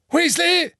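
very high, loud eager call. A narrator's reading. Minister calls out gleefully to his clerk, loud. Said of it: "rief Fudge".

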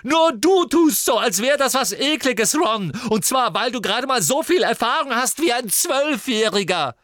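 very high, loud, strained shouting; furious and close to crying; Ginny yelling at Ron on the verge of tears; angry, near tears. Acted. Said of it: "als sei sie den Tränen nahe".

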